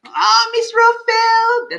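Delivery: high, loud, drawn-out welcome; delighted call. Real, not acted. Host spots a favourite viewer, delighted. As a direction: stretch the name out with delight.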